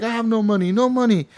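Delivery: high mock-accented plea; pleading German man. German debtor pleading poverty to Arthur, high mock accent. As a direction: whine it high like a broke foreigner. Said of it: "he's like".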